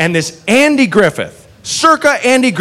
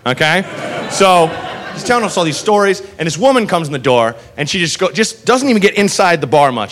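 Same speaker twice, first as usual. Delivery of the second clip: normal talking voice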